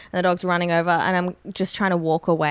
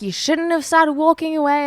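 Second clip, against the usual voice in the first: higher-pitched mock scolding; retold yelling; 9 semitones higher than usual. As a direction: imitate a stranger telling you off, mildly. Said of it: "she was just yelling at me".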